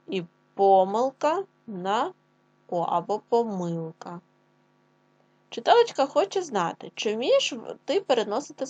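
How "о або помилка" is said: normal talking voice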